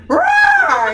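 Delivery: high, loud, playful mock lion roar; big cartoon roar, laughing through it. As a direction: let it rip like a startled lion. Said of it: "the lion just went".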